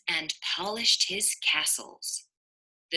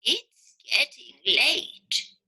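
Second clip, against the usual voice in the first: sleepy; 6 semitones higher, 3 dB louder, slower than usual. Acted. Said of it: "he said".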